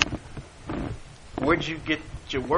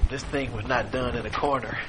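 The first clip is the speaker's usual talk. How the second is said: softer words breaking into a chuckle; laughing through quote; 5 semitones lower, 8 dB quieter than usual. Real, not acted. Preacher delights in Paul's reply to Agrippa, laughing. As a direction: let the laugh creep in as you quote.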